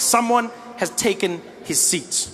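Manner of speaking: slow, drawn-out knowing line; mock dramatic realization. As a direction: spell it out slowly. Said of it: "I'm like".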